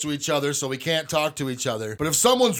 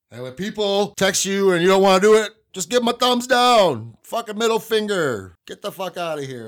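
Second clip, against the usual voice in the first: worked-up rant; 5 semitones higher, 4 dB louder than usual. Real, not acted. Ranting about ignored texts.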